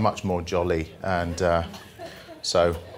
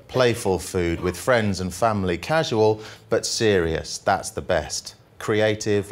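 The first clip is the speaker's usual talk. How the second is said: jolly poem reading; 2 semitones higher than usual. Acted. Comic poet reading the happy poem to the audience, upbeat. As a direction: read it like a cheerful ode.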